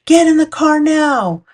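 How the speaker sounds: high, loud retold shout to get in the car; Mom's urgent yell. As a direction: call it out high and loud like a mom spotting a moose. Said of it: "Mom yelled".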